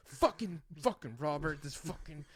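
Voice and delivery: mock sputtering rage, a touch of laughter; imitated fuming. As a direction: sputter curses like an exasperated friend. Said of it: "Devin starts fucking fuming".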